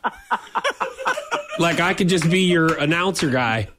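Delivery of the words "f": high-pitched cracking laugh. Real, not acted. Breaks into laughter.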